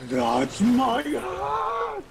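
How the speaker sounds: very high, very slow cry of outrage; high stretched-out indignant yell; outraged shout. Acted. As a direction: yell at the thieving monkey. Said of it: "and he just goes".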